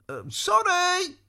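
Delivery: high, drawn-out sorry; apologetic squire. A narrator's reading. Squire apologising to Maudmont, high and sheepish. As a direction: squeak out a sheepish apology, slowly.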